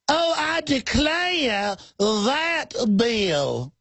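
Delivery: high, very slow Southern belle drawl. Retold or imitated. Host mocking a congressman as a fainting Southern belle. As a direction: swoon it out like a fluttery belle. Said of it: "Literally talks like Scarlet".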